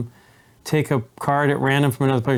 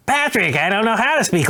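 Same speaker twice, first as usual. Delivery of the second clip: cartoon kid voice; 8 semitones higher than usual. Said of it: "if you talk like a cartoon character".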